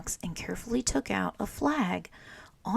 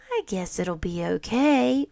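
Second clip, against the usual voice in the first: plain reading voice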